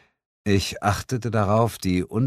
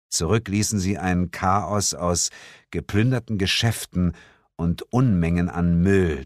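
plain reading voice

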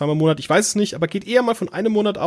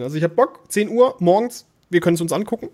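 normal talking voice

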